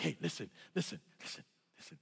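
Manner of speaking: low voice fading into a near-whisper; hushed calming. Retold or imitated. Instructor calming the shaking jumper, hushed.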